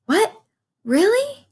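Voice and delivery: a pig suddenly given hope; surprised cry. A narrator's reading. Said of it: "cried Wilbur".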